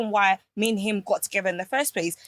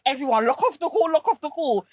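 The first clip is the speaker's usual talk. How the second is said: higher-pitched, brisk order to the team; imitated urgent manager; 5 semitones higher than usual. Manager tells the team to leave, imitated urgency. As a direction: retell a manager hurriedly clearing a call.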